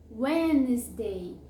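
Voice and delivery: "Wednesday" slowly as WENZ-day, no first D; silent D. Shown as a demonstration. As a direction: draw out "Wednesday" in two syllables, WENZ-day. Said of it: "ele é mudo".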